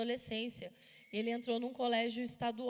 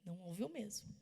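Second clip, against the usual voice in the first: softer, thinking it to herself; inner thought; 4 semitones lower, 8 dB quieter than usual. Retold or imitated. Mother silently agreeing with herself, much softer. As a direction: say it under your breath as a private thought. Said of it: "Pensei comigo".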